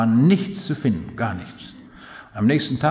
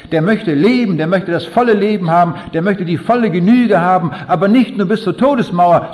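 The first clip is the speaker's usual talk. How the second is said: louder, higher, driving sermon climax; full-force preaching; 4 semitones higher, 5 dB louder than usual. Acted. Preacher urging the audience toward eternal life, full force. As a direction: preach it out to the back row.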